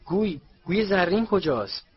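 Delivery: excited spectator's question; urgent question. A narrator's reading.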